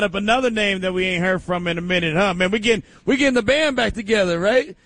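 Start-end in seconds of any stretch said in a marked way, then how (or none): none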